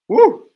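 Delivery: very high, loud whoop; excited whoop. Real, not acted. Commentator reacting to the aerial contest, excited whoop. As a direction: let out a burst of a whoop.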